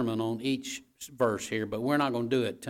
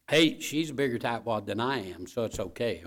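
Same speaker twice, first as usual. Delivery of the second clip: ordinary delivery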